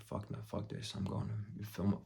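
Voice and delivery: very soft, half-whispered inner grumble; muttered refusal. Retold or imitated. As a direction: mutter it to yourself, barely voiced. Retells thought of walking away.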